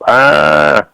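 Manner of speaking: a short high "Ah" sound, like an animal calling out in the woods; imitated creature cry. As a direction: give a quick high call, like something in the dark. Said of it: "it went".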